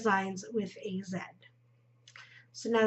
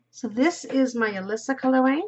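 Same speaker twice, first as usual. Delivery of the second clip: ordinary talk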